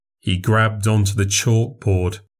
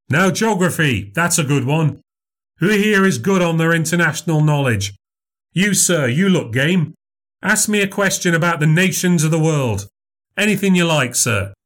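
higher, bright showman voice performing for a crowd; showman memory act; 8 semitones higher than usual. Acted. Memorizer invites questions.

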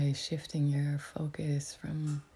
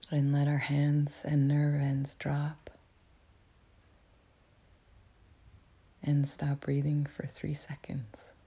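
whispering. Real, not acted. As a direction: whisper it gently, like guiding a meditation. Whispers throughout to guide meditation.

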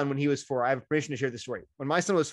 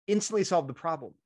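ordinary talk, nothing marked

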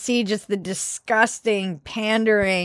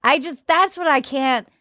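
ordinary delivery

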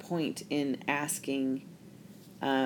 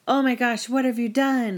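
much higher and louder, aghast; shocked accusation; 8 semitones higher, 7 dB louder than usual. Acted. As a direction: burst in horrified at what they did. Notice that he doesn't come in.

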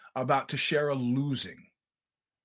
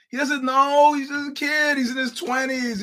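insistent defence; 9 semitones higher, 4 dB louder than usual. Real, not acted. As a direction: push it up, he just doesn't know. Pleading for the naive kid.

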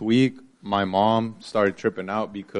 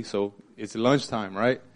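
ordinary delivery

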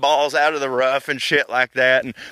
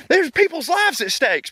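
heated insistence. Retold or imitated. Continues the outburst.

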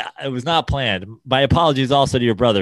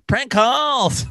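radio DJ voice; 10 semitones higher than usual. Retold or imitated. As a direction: announce it like a hyped radio host. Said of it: "sound like a morning radio D J".

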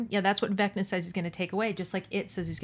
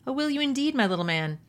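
high, lilting opening falling back down; tolerant amusement; 7 semitones higher, 4 dB louder than usual. A narrator's reading. Villain answers boy's threat, tolerantly amused. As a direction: humour him with lightly mocking indulgence. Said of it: "The thought was tolerant, amused".

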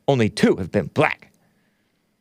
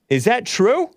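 incredulous; 10 semitones higher than usual. Real, not acted. Asking again, higher.

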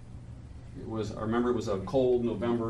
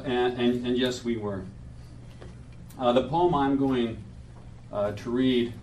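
normal talking voice

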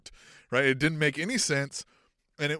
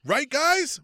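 high, louder "Right, guys?"; needy high appeal; 9 semitones higher, 8 dB louder than usual. Retold or imitated. Mocking Cody asking fans to agree, needy high appeal. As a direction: fish for approval, voice pitched up. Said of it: "because he's like".

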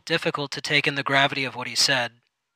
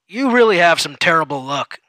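boss voice; 3 semitones higher, 7 dB louder than usual. Acted. Supervisor comments.

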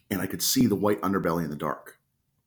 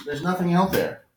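much higher, sing-song reassurance; high dog voice; 10 semitones higher than usual. Real, not acted. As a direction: tell the dog it's nothing, light and high.

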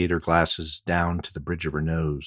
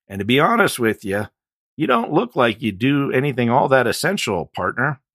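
higher, louder, mocking cop; disdainful deputy; 8 semitones higher, 8 dB louder than usual. A narrator's reading. Deputy sizing up the biker, scornful. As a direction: look down on him out loud.

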